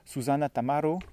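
« Susanna Tamaro » à l'italienne; accent italien. Shown as a demonstration. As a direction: prononce le nom avec l'accent italien. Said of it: "avec un fort accent italien". (nom d'autrice avec accent italien)

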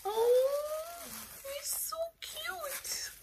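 tiny, very high squeak fading to breath; melting with adoration. Real, not acted. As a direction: coo softly, high and drawn-out. Melting over a gnome.

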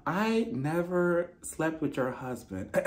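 soft, slow mock line run; forgot the script. Host plays Jacqueline fumbling her script, soft and slow. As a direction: quietly feel out a half-remembered line. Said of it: "I forgot my script".

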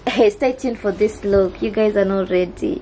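ordinary delivery

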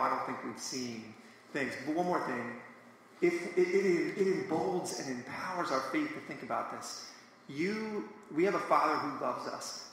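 ordinary talk

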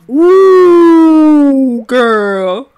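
put-on slow swooping high ooh girl; loud drawn-out amused ooh. Mock gasp over game animation.

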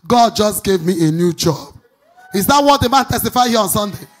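loud, driving sermon delivery; preaching at full force. Acted. Pastor rousing the congregation with his prophecy, preaching loudly. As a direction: hammer each line home to the crowd.

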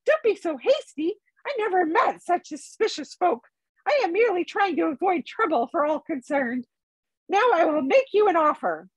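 a polite hobbit bargaining with the Elvenking; Bilbo the hobbit. A narrator's reading.

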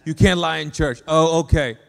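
loud sarcastic echo of the rule; mocking agreement. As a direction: throw it back loudly as if conceding. Mocks the church-only rule back.

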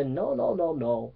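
soft, steady no to the devil; hushed, trusting reply to the inner voice; firm quiet refusal. Retold or imitated. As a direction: quietly push the voice away, calm and sure. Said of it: "you say".

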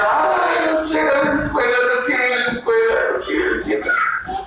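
high, whiny lazy cat; high whining "no quiero ir a la escuela". Acted. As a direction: whine like a lazy cat who won't get up. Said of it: "decía".

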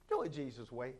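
low, soft entreaty; hushed plea. Real, not acted. Preacher begging listeners to follow Jesus, low hushed plea. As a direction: drop right down and gently implore them.

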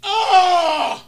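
high, loud startled cry; shocked shout. Real, not acted. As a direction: yell at the twist. Opponent blocks, shout.

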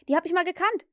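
put-on high, loud boyish boast; cheeky child's interjection; calling out. A narrator's reading. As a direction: pipe up to needle the others. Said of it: "rief John".

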